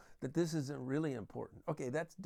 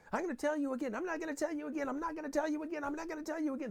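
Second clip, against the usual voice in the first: high, insistent parental harping; mock nagging yell; 10 semitones higher, much faster than usual. Parents who keep yelling at their kids, mock high-pitched nagging. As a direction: repeat it on one high pitch, like a broken record. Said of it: "And they're yelling".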